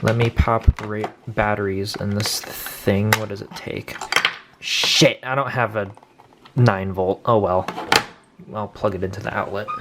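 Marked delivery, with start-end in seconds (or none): none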